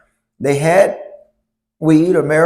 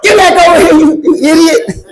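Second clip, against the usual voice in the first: high, loud laughing yell; coach yelling; 12 semitones higher, 7 dB louder than usual. Retold or imitated. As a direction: call the boy back, exasperated. Coach yells at him.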